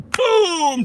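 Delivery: high, loud, stretched boom; explosive play-acted "boom"; mock slap impact. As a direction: shout it as a comic impact noise. Acting out slaps for likes.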